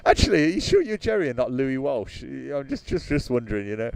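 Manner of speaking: ordinary talk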